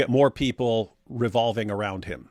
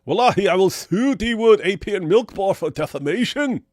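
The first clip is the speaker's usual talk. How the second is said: high, loud mocking voice; mock an outraged opponent; 8 semitones higher, 5 dB louder than usual.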